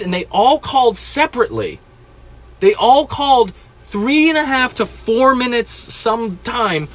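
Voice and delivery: ordinary talk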